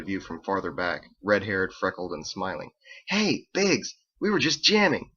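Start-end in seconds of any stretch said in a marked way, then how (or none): 3.1-5.1 high, upbeat hello; cheerful greeting. A narrator's reading. Roommate greets Biggs from farther back, cheerful. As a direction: pipe up eagerly from the back.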